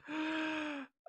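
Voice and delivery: a thin high giggle; high held laugh; high squeaky laugh. Real, not acted.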